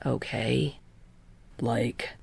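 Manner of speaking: soft, trailing off; hesitant whisper. A narrator's reading. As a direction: falter into a whisper.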